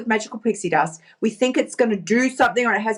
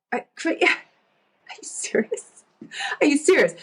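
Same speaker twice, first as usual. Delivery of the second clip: incredulous disbelief; 6 semitones higher than usual. Retold or imitated. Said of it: "And I'm like".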